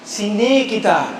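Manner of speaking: slow Sarawak Malay, sini falling; Sarawak dialect. Shown as a demonstration. Lecturer repeating the Sarawak phrase, Sarawak accent. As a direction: say it the Sarawak way again. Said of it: "slang Sarawak ni".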